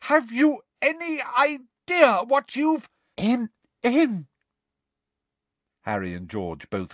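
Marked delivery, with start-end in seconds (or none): 0.0-2.9 high, slow outraged demand; furious bellow. Acted. Bellowing professor, cut off mid tirade. 3.2-4.3 high, prim little cough; fussy attention-seeking cough; affected throat-clearing. Acted. Umbridge interrupts with fussy cough.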